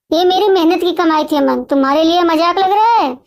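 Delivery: plain reading voice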